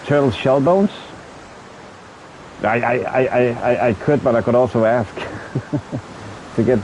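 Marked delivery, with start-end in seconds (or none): none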